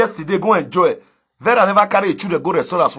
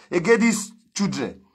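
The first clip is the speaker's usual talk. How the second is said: ordinary delivery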